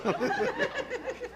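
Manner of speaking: high soft laugh; laughing at herself; high giggle. Real, not acted. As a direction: giggle lightly. Laughs after chant.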